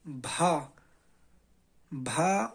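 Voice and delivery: بھا said with a breathy, aspirated بھ; aspirated sound. Shown as a demonstration. Teacher drills the aspirated بھا sound. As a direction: repeat بھا, a puff after the b. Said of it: "اس کا تلفظ ہوگا".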